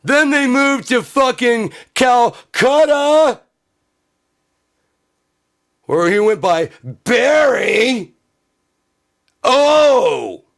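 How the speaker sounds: high, loud, slow, scandalised yell; outraged shout. Acted. Voter ranting about Obama's childhood, outraged shout. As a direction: bellow the outrage like it's a scandal.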